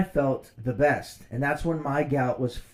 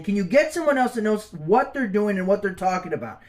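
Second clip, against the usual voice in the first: higher, louder, indignant demand; heated retort; 6 semitones higher, 3 dB louder than usual. Retold or imitated. Patient telling off his doctor, indignant and louder. Retold angry confrontation.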